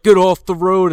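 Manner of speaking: loud, high-pitched mock order; angry mimicked yell. Podcaster imitating the British guy harassing Cranston, angry yell. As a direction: shout it like an irate driver.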